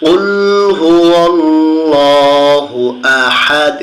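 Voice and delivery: slow, drawn-out melodic tilawah; Quran recitation. Shown as a demonstration. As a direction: stretch হুয়াল্লাহু in a long chant. Teacher recites the verse again.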